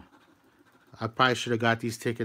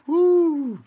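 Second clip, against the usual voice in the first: excited whoop; 7 dB louder than usual. Real, not acted. Cheering at a scratch-off win.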